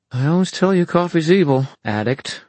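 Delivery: high playful scolding; teasing. A narrator's reading. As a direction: tease her fondly. Emma teasing her friend.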